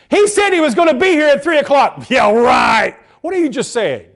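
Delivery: loud, high, mocking doubt; scoffing disbelief. Doubting somebody's word, loud sarcastic scoff. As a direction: scoff loudly, laughing it off.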